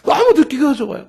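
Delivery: ordinary talk, nothing marked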